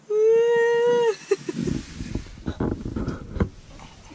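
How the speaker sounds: breathless high giggle; high laughing fit. Real, not acted. Can't open the candy bottle.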